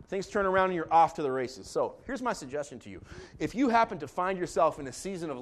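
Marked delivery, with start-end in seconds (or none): none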